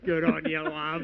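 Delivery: mock awkward laugh. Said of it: "he's like".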